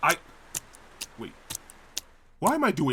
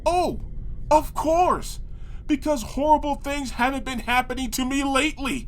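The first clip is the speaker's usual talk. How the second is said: sarcastic; 7 semitones higher than usual. Acted. Sarcastic reply to narrator.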